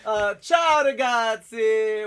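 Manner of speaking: loud, high, slow hammed-up Italian host voice; mock Italian accent. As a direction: put on a big fake Italian accent to open the show. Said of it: "Luca Misaglia accent".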